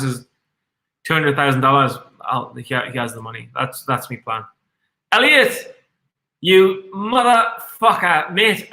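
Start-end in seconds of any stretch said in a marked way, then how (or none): 5.1-8.7 high, loud teasing shout at a viewer; playful call-out. Real, not acted. Streamer ribbing viewer Elliot in chat, a high, loud, playful shout. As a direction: call out the chatter like a mate.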